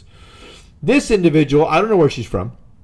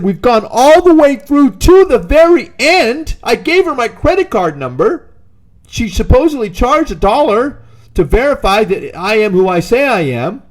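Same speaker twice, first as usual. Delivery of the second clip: exasperated retold complaint; 7 semitones higher, 3 dB louder than usual. Arguing with the Sprint agent.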